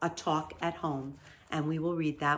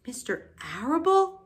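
high, rising disbelief with a touch of a sob; sobbed question; 8 semitones higher than usual. A narrator's reading. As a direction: ask it in mild tearful shock. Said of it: "sobbed Wilbur".

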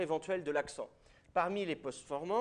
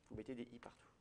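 softer, lower bait to get a reaction; quiet repeat; 5 semitones lower, 15 dB quieter, faster than usual. Shown as a demonstration. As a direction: let it drop low and soft, waiting. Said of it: "j'attends que quelqu'un réagisse".

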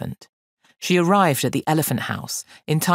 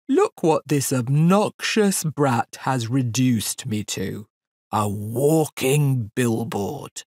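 slow, ponderous, indignant elephant; aggrieved elephant; slower than usual. Acted. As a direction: draw the words out like a wounded great beast.

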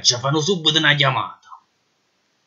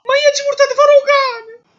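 Neapolitan clown voice; 4 dB louder than usual. Acted. The detainee cuts in.

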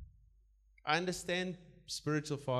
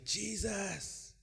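fervent cry; 8 semitones higher than usual. Shown as a demonstration. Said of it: "you say".

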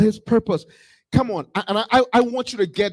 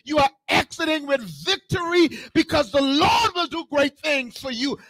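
raised, ringing sermon voice; fervent preaching; 8 semitones higher than usual. Real, not acted. Preacher promising believers victory even in death, fervent preaching. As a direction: proclaim victory to the congregation.

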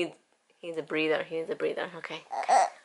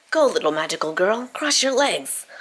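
normal talking voice

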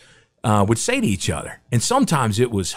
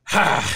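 disbelieving ha; 10 semitones higher than usual. Retold or imitated. Coach's disbelieving reaction.

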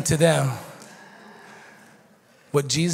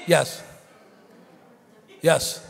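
ordinary talk, nothing marked

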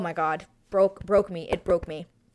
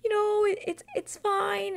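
comforting the groom; 14 semitones higher than usual. Retold or imitated. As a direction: play her brave and gentle, much higher. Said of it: "She said".

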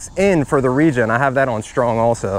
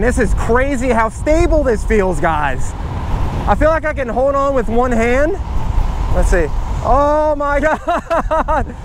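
excited yelling; 9 semitones higher than usual. Real, not acted. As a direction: call out the speeds in disbelief. Top speed test race mode.

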